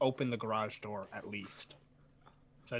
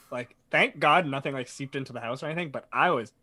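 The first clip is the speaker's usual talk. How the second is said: ordinary talk, nothing marked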